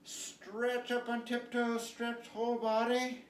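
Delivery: high and slow, drawing the stretch out; slow, high coaching call; slow lifted stretch cue. Acted. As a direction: coax them up onto tiptoe. Instructor cueing stretches.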